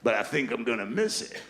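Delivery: a hint of a too-casual airline captain; cocky pilot. Pilot on the intercom telling passengers about the weather, casually cocky. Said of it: "Why is he talking like that? What's with the attitude?".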